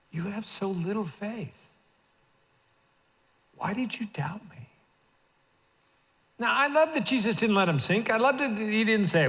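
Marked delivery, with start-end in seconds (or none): 0.1-4.7 gentle rebuke. Acted. Jesus to Peter, a soft whispered rebuke. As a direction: whisper it, tender but searching.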